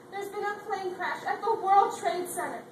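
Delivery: loud, urgent news blurted out; urgent alarm. Acted. Friend bursting out the crash news to Lisa, loud and urgent.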